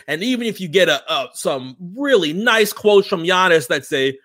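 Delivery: usual speaking voice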